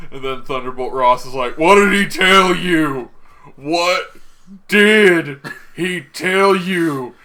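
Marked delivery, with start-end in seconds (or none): 1.6-3.1 loud, high, slow hammed-up interrogation; mock screaming demand. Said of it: "grabbing onto his shirt and screaming". 3.6-7.1 very slow, loud, high, word-by-word yell; hammed-up interrogation, every word drawn out; mock screaming demand. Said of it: "grabbing onto his shirt and screaming".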